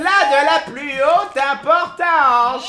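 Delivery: drawn-out, high, loud proclamation; pompous announcement. Acted. Player grandly presenting a dish to monsieur, pompous announcement.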